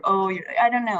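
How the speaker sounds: ordinary talk, nothing marked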